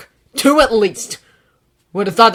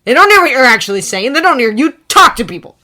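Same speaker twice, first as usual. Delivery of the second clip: louder, higher, fast angry tirade; furious rant; 6 semitones higher, 14 dB louder than usual. Acted. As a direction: rant it hard.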